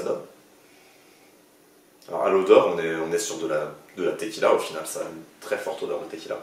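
glass held at lips; talking while sniffing drink; speaking into a glass. Real, not acted. Seen 0: Glass held under nose and mouth throughout the labelled speech.

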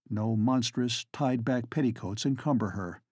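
usual speaking voice

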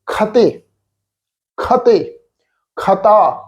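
ਖਤੇ said lightly, no doubled ਤ, with the dotted ਖ਼; correct light pronunciation. Shown as a demonstration. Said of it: "ਹਲਕੀ ਜਿਹੀ tone ਵਿੱਚ ਪਾਠ ਉਚਾਰਨ ਹੋਏਗਾ". (ਹਲਕੀ ਟੋਨ ਵਿੱਚ ਸਹੀ ਉਚਾਰਨ)

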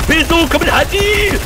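high, slow yelled warning; loud warning shout. Real, not acted. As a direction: call it out to your teammate. Warning call during a fight.

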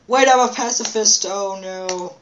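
high, loud sudden alarm; yelped realization; surprised. Real, not acted. Sudden panic in game.

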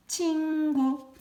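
very slow, loud 친구 drawn out high; slow model word. Shown as a demonstration. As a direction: stretch 친구 for kids to repeat. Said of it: "다 같이 해볼까요?".

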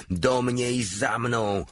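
rally the troops, a touch drawn out; roaring command. Acted. Major rallying troops uphill, slow roaring battle cry. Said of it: "ryknął".

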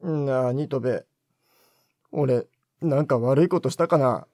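low, heavy despairing murmur; dejected. Acted. Despondent director asks the junior if he did wrong, low and heavy. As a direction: sink into self-blame, voice dropped low. Director brooding alone in dark.

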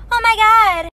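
loud, high shocked gasp; hammed-up outrage; mock shock. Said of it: "she's all like".

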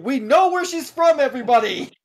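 high, loud triumphant proclamation; gleeful shout to everybody; excited announcement. Acted. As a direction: announce it to the room like a big reveal. Triumphant joke announcement to the group.

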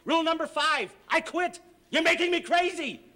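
exasperated shout. Acted. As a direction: lose it in comic frustration. Harvey loses his temper.

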